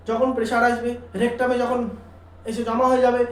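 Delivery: normal talking voice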